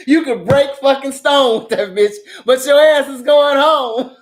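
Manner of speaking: loud laughing through the words. Real, not acted. Teasing the audience about sending him home, laughing loudly. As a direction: crack up while you say it, loud and high. Cackling at her own joke.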